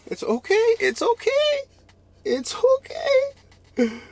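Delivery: squeaky high, mock-sobbing self comfort, slowing to a wail; mock crying. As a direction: pretend to cry it's okay to yourself. Consoling himself after losing fish.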